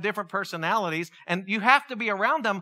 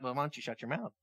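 much softer, lower grumbled comeback; muttered retort; 6 semitones lower, 11 dB quieter, faster than usual. Retold or imitated. Struggling believer muttering back at people preaching trust, much softer. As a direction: mutter it under your breath at the advice-giver. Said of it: "you're kinda like".

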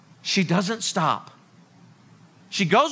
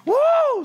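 high, loud whoop; celebrating mascot cheer, far higher; mock cheer; 4 dB louder than usual. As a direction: whoop like a fan.

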